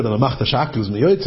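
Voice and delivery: usual speaking voice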